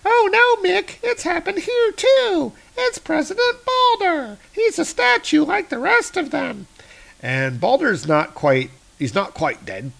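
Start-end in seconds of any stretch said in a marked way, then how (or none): 0.0-6.7 put-on very high squeaky kid voice. Kevin finds Baldur turned to stone, high squeaky kid. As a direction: pitch way up like a frightened little boy. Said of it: "Kevin is like".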